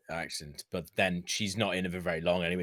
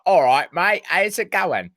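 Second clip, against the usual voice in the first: higher, louder hammed-up British accent; comedy British accent; 9 semitones higher, 8 dB louder, slower than usual. Mocking an actress being like a mate, comedy British accent. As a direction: put on a comic British accent, loud and high. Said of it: "really comedy accent".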